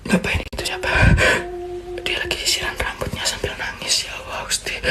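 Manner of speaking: very soft, voiceless whisper; frightened whisper. Real, not acted. Asking who sits at the dressing table, frightened whisper. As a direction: whisper so the figure won't hear. Whispering as apparition combs hair, crying.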